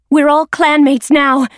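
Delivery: put-on young she-cat reminding. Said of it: "the former River Clan warrior reminded her".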